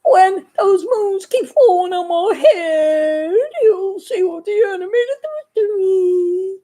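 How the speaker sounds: slow crying shout; dramatic crying trailer line. Acted. Vlogger teasing the cartoon, slow crying dramatic wail. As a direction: wail it like a movie trailer hero.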